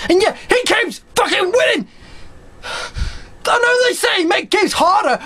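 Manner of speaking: high-pitched, strained rage rant; losing gamer's shrieky tirade; pissed off. Real, not acted. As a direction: spit curses in real, worked-up fury. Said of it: "I'm getting fucking pissed off!".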